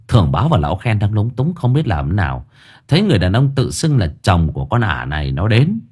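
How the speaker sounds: usual speaking voice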